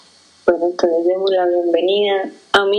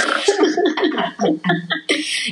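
risita aguda; 7 semitones higher than usual. Real, not acted. Risa en charla de pódcast.

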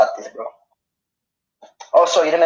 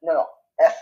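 ordinary delivery

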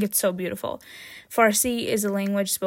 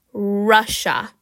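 ordinary talk, nothing marked

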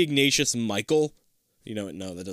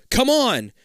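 urging; 13 semitones higher, 6 dB louder than usual. Acted. Urging friend.

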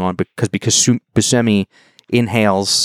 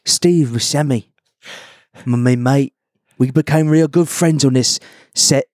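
lightly put-on Cockney, a bit higher; goofing as a British rock star; mock British accent; 3 semitones higher than usual. Podcast host mocks the actor in a light British accent. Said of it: "I don't know where I'm going with that accent".

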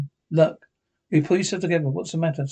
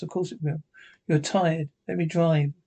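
ordinary delivery

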